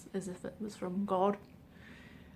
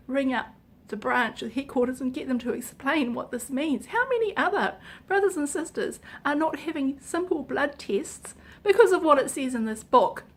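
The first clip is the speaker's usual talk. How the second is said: louder, higher, outraged; real anger; 4 semitones higher, 6 dB louder than usual. Real, not acted. Vlogger venting at the book's authors, outraged and loud. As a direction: let the fury out at whoever wrote the book. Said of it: "I am so pissed off".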